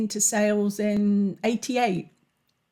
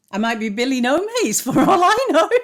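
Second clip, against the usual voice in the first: loud, high, quick laughing delivery; 5 semitones higher, 7 dB louder, faster than usual. Real, not acted. Host joking she might have no audience, laughing. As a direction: break into real laughter as you talk. Laughs at own self-mocking joke.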